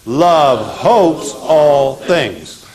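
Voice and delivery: very slow, every word drawn out for the class; slow recitation. Shown as a demonstration. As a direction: chant it slowly so they can repeat. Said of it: "Say it with me".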